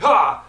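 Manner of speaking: sudden loud yelp of blinded eyes; quick pained cry, jolted. Retold or imitated. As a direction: blurt it like a light hit your eyes. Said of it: "your eyes are just like".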